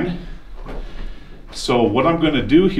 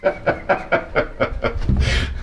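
airy laugh; 7 semitones higher than usual. Real, not acted. Laughing on the commode.

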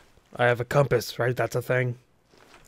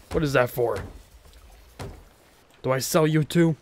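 ordinary talk, nothing marked

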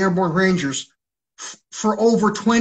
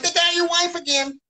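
high, taunting mimicry; mocking taunt; 10 semitones higher than usual. Imitating the soldiers taunting him, high mock voice. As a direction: mimic a gloating tormentor, much higher. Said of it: "they're like".

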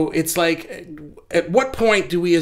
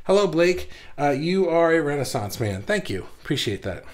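ordinary delivery

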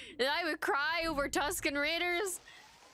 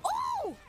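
high-pitched "oh" squeal; alarmed shriek. Real, not acted. Fearing for favourite in fight.

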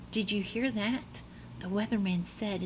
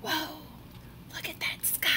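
ordinary delivery

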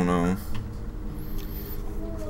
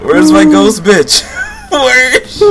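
high, loud, laughing near tears; laughing shout; 6 dB louder than usual. Real, not acted. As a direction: yell while cracking up. Shouting, laughing during chaos.

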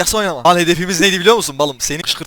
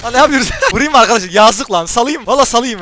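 excited; 7 semitones higher, 7 dB louder than usual. Real, not acted. Excited decision mid-game.

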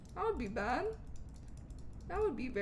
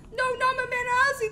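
high, loud mock-distraught cry; playful, high-pitched shout of dismay; dismayed outcry; 10 semitones higher, 8 dB louder, faster than usual. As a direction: wail in protest for a pal in danger. Cries out over game character's danger.

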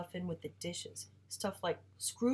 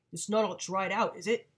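louder suspicious father voice; grumbling father; 2 semitones higher, 8 dB louder than usual. Acted. Father grumbling to mom about dried-out supper. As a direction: challenge the cook with a loud grumble.

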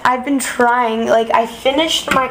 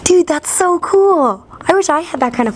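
mock impressed; 6 semitones higher than usual.

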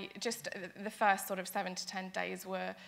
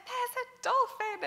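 high, louder mock scream; retold excited scream; 5 dB louder than usual. Rower calling crewmates at her first dolphin sighting, retold scream. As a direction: re-enact a thrilled cry, pitched way up. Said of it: "and I screamed".

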